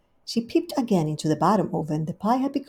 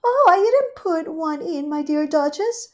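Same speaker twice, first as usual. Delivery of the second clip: much higher, louder gushing hostess; Rivy's voice; 10 semitones higher, 6 dB louder than usual. Acted. Rivy welcoming Duchess, high gushing hostess. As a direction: greet her sweetly and grandly.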